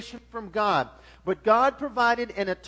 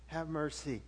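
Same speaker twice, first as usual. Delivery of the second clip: low, much softer "Have mercy" murmured; quiet plea; 6 semitones lower, 10 dB quieter than usual. Shown as a demonstration. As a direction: say "Have mercy" softly to yourself. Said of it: "you ever been in a situation?".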